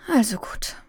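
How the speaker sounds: whispered, resigned sigh. Acted. Mother gives in to her crying daughter, whispering with a sigh. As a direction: give in with a breathy sigh. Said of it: "Mama hat geseufzt".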